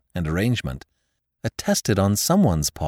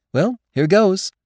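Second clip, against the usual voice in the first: put-on cheerful young man; 12 semitones higher, 5 dB louder than usual. Nephew signs cheerfully.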